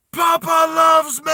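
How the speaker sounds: high, slow, full-throated shout; joyful yell. Acted. Boy yells his joy into the forest, loud and high. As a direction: yell it out into the wilderness. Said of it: "He yells out".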